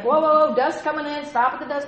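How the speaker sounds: loud, high mock panic; exaggerated urgent stop call; mock alarm. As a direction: call a halt as if alarmed, hammed up. Acting alarm at incoming dust.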